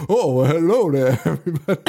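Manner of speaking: light, mock fake Cajun accent, laughing through it; a hint of a hammed-up fake Cajun accent. Retelling the tour guide magician in a hint of fake Cajun accent. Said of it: "fake Cajun accent is pretty funny".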